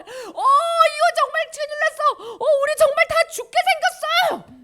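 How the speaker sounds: high, loud acted panic; panicked disciples. Disciples crying out in the sinking boat, high panicked wail. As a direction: cry out as if the boat is going under. Acts disciples fearing death in storm.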